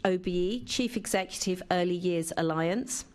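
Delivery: ordinary delivery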